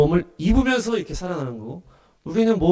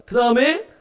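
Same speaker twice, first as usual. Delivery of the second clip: normal talking voice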